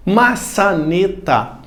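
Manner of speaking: "Maçaneta" very slow, in four separate syllables. Shown as a demonstration. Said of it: "Professor, quatro sílabas".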